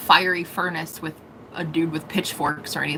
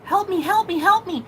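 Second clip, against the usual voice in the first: higher, whiny plea; mock begging souls; 9 semitones higher than usual. Souls begging for help in the void, higher mock plea. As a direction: echo the ghosts crying help, higher.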